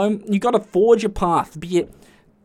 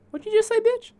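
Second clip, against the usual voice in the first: high, sassy outraged challenge; high-pitched what did you just say; indignant challenge; faster than usual. Retold or imitated. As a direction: throw it back at her, offended. Confronting retold.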